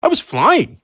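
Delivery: high, loud cow voice; mooing. Acted. The cow moos at Jerry, proud of flying. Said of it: "the cow moos".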